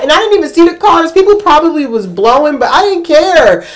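ordinary talk, nothing marked

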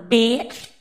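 sudden loud yell on the last word; shouted insult. Acted. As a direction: blast the final word at the viewer. Bee insult punchline.

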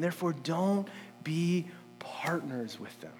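hushed, breathy whisper of the verse; whispered warning. Acted. Preacher whispers Paul's warning to the congregation.

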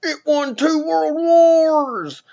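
high, loud, slow mocking boomer wail. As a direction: whine it like a proud old gun nut. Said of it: "their big shtick about the whole thing is".

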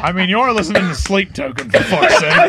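high, loud laughing tease. Real, not acted. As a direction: crack up while teasing. Teasing friend, laughing loud.

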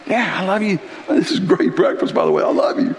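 tossed-off reply, trailing into a near whisper; Peter answering. Retold or imitated. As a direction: Peter answering Jesus with his mouth full.

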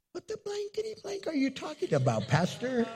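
high, fast, indignant retort; indignant cusser. Retold or imitated. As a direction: snap back in mock outrage.

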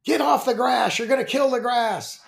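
high-pitched mock yell from mom. Mom yelling at kids trampling the front yard, high-pitched mock yell. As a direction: pitch it way up like a mother shooing kids off the lawn. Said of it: "my mom came out and yelled at us".

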